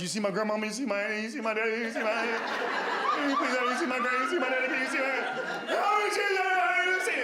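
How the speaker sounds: pestering dead man. Acted. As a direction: badger God like an eager nuisance. Dead man pestering God about relatives.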